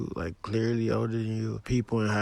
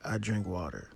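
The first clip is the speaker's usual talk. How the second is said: ordinary talk, nothing marked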